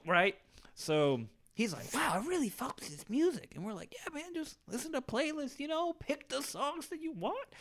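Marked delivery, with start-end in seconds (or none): 1.9-3.5 high, put-on voice of the kid; high mock kid voice. Mimicking the kid praising their music, pitched up. 3.9-7.5 high, put-on egging-on voice; fake-friendly tease, pitched way up; high mocking voice. Friends egging the kid to hit the bag, in a high mock voice.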